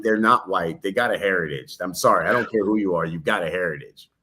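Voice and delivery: ordinary talk, nothing marked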